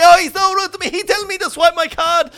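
high, loud mock screech; hammed-up indignant shrieking; snapping customer. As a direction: imitate an outraged customer at full pitch. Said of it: "she's just snapping at me".

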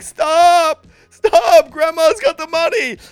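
put-on loud, high kids yelling after the van; a queue of kids yelling after the ice cream van; kids shouting. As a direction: call out at him as you run behind the truck. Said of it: "queue of kids running".

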